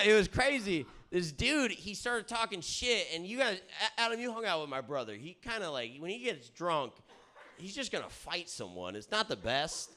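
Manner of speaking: ordinary talk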